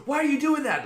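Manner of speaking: high, loud squeal of mock horror with a laugh; laughing mock-horror yelp; mock horrified shriek. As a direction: shriek it like a moviegoer caught in the horror. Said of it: "You'd be like".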